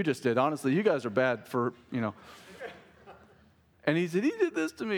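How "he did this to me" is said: very high-pitched mock wail; imitated sobbing. Son complaining to dad about another player, mock uncontrollable sobbing. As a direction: blubber it like an upset little boy. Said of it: "he's just sobbing uncontrollably".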